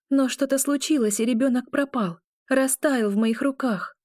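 high-pitched, mildly distressed telling; a touch of dismay; uneasy recollection. A narrator's reading. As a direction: recount the loss with pitch held up. Heroine recounts losing child in dream.

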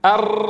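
أر with one light tap and the sides of the tongue pressed; just one flick of the tongue on أر; single tap r. Shown as a demonstration. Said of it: "رعدة واحدة".

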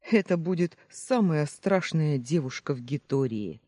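plain reading voice

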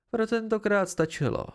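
worn out but pleased; exhausted. Acted. Pente, worn out after a long day with him, a touch tired. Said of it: "podle hlasu jasně vyčerpaná".

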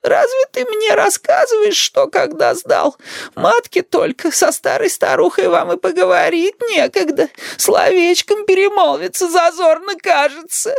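put-on very high, tearful old-woman reproach; whining, wronged old grandmother; aggrieved grandma. A narrator's reading. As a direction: lament slowly in a high granny voice.

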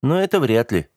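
mildly doubtful, starting high and falling; understated scepticism. A narrator's reading. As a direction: voice a touch of doubt. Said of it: "с сомнением произнёс я".

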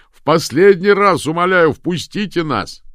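very loud, high begging; imploring yell over the wind; desperate shouted plea. A narrator's reading. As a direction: beg at full voice through a locked door.

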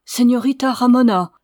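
appel plus haut et plus fort, ému; s'écrier. A narrator's reading. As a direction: crie son nom, bouleversé de la voir pleurer. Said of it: "s'écria-t-il". (s'écrie plus fort et plus haut, ému)

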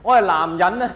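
ordinary delivery

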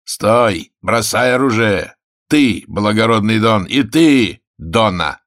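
booming hoarse challenge; hoarse inhuman roar. A narrator's reading. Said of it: "неожиданно проревел".

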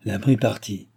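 usual speaking voice

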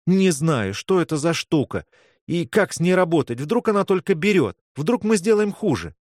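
high, worried run of doubts; anxious protest. Acted. Girl afraid the amulet makes it worse, anxious protest. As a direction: voice climbing with worry. Panicked doubt during resuscitation.

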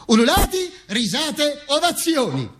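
very high, ringing list; exultant cry. Acted. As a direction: throw each word up high like the crowd's uproar. Acts out the crowd uproar. (esclamazione acutissima)

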